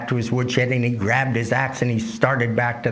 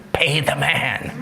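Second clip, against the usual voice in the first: muffled voice from chest; 4 semitones higher, slower than usual. A narrator's reading. Pawnbroker hidden in the chest, muffled urging. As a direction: call out from inside a shut chest. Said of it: "there was a voice from the chest".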